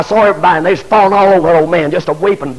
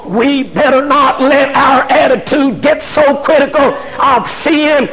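rising shouted preaching; full-force preaching; 5 semitones higher than usual. Acted. Preacher shouting at church about critical spirit.

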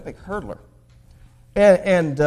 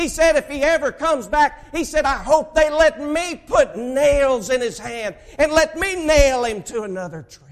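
higher and louder preacher's retelling; preaching; 10 semitones higher, 10 dB louder than usual. Acted. Preacher retelling a stranger's curse to his church, fiery. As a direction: hammer the curse out to the pews.

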